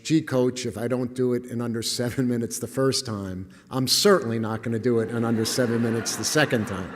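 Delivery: ordinary delivery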